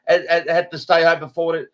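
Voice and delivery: ordinary talk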